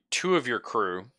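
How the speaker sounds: ordinary talk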